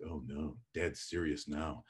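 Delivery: put-on low, soft voice of worried children; really quiet. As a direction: drop low and soft, imitating hushed kids. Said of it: "then you get really quiet".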